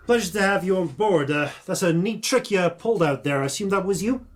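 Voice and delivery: loud, high and fast; brash orc governor. Acted. Orc governor greeting the crew, loud and quick. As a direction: toss it off like a grinning brawler.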